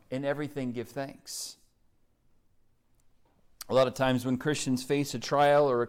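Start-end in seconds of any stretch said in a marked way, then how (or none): 0.1-1.5 much softer, hushed verse; soft, hushed quote. Acted. Preacher correcting the misquote to the church, hushed. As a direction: say it gently, almost under the breath.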